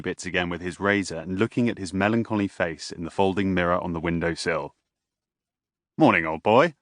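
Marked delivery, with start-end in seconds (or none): 6.0-6.7 bright cheery morning greeting, louder; a father calling good morning. A narrator's reading. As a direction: call out cheerily to his son. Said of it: "he called cheerily enough".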